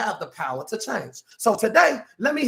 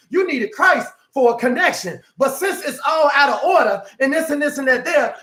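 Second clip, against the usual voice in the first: usual speaking voice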